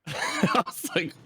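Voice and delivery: high-pitched laugh through the words; cracking up, half crying. Real, not acted. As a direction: laugh in disbelief, voice breaking. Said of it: "That is wild".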